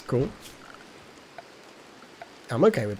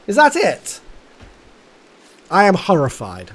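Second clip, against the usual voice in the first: loud, high hammed-up indignation; theatrical dismay; mock horror; 5 semitones higher, 7 dB louder than usual.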